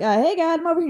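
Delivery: calling up to God, half joking; mock call to God. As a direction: wave God down. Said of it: "you're like".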